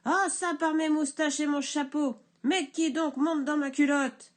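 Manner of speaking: cri furieux, plus aigu et plus fort; hurle, paniqué par la bête; hurle et crie. Acted. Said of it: "le bûcheron hurle et crie". (le bûcheron hurle et crie)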